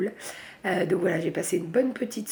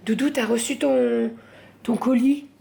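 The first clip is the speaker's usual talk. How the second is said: raised voice across to a loved one; calling out; 5 semitones higher, 5 dB louder than usual. Real, not acted. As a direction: call over to your partner, louder and higher. Calling to partner who came home. (interpeller son conjoint à voix haute)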